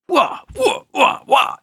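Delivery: high-pitched karate flourish wahs; mock karate noises. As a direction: pretend kung fu whooping. Imitates slow-motion karate flourish.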